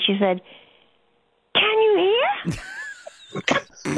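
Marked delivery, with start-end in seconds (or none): 1.5-3.9 mock toddler yell. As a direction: shout to nana as if she's deaf. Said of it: "she yelled at me".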